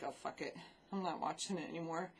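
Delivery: unvoiced, half-whispered resignation; muttered giving up. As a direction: mutter it like you're done. Giving up on the show.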